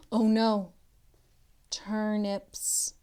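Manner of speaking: ordinary delivery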